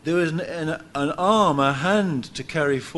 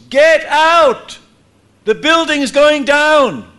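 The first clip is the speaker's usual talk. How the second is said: high, loud alarm yell; shouting a warning; 10 semitones higher, 9 dB louder, slower than usual. Retold or imitated. Man on the stairs warning everyone, loud alarm shout. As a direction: bellow it up the stairs to empty the building. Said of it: "shouting at the top of his voice".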